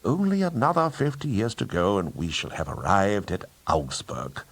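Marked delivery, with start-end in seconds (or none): none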